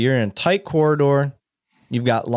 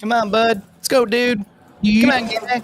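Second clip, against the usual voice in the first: much higher, teasing buddy voice; squeaky mock of a tough guy; 11 semitones higher, slower than usual. Mocking the cop's buddy talk.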